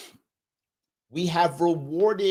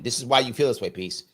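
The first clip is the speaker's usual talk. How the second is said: normal talking voice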